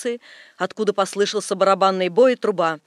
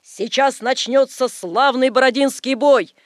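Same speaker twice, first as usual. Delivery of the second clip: raised, theatrical announcement of battle; stage proclamation; 4 semitones higher, 5 dB louder than usual. A narrator's reading. Napoleon announces the battle of Borodino, stage proclamation.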